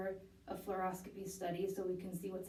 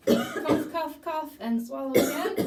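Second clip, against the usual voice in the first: loud urgent command; 7 semitones higher, 10 dB louder, slower than usual. Real, not acted. Clinician prompts patient to cough.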